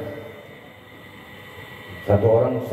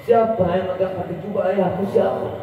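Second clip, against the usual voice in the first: mild grief; 6 semitones higher than usual. Acted. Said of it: "Habibah Fatimah nangis".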